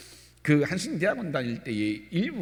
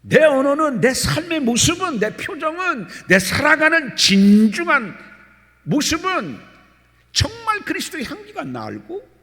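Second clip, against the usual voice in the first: loud, high, fervent sermon; preaching at full force; 11 semitones higher, 8 dB louder than usual. Acted. Pastor exhorting his congregation, loud and high. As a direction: exhort the congregation to examine themselves.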